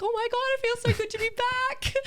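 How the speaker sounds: high, bright-eyed gush of relief; delighted. Retold or imitated. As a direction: squeal it like she's thrilled to be back. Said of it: "She's like".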